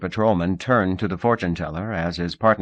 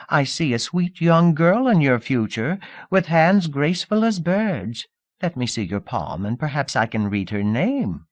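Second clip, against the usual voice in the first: fortune-teller patter; 8 semitones higher than usual. Acted. Fortune-teller's sweet-talking patter.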